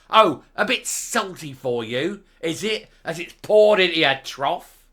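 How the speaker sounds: loud, mocking sneer; scornful mock question, loud; sarcastic jeer. Real, not acted. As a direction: taunt her with scorn. Mocking the politician's complaint.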